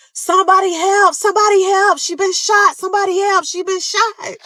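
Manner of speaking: high frantic shouts for help; cousin's screaming. Retold or imitated. Cousin carrying her in, screaming for help.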